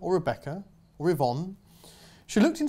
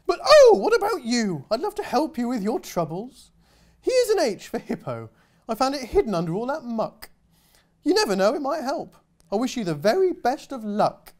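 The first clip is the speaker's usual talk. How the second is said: much higher, louder dainty hippo; grateful hippo; 7 semitones higher, 5 dB louder than usual. Acted. Hippo thanking the girl, gushing and high. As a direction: gush like a prissy hippo.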